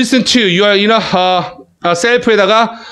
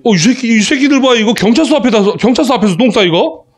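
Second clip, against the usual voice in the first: higher-pitched mock indignation; indignant policemen; 5 semitones higher than usual. Policemen to someone caught outside their station, indignant. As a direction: imitate startled cops scolding offenders. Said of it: "딱 내리면서 아저씨들".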